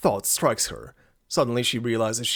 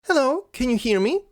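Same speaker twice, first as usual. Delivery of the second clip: high-pitched mic check; tentative public speaker; 12 semitones higher, 3 dB louder than usual. Acted. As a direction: ask the crowd in a raised character voice. Nala checks the crowd can hear.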